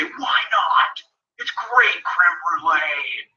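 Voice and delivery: pushy waitress. Pretend. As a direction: lean on her like a needy seller.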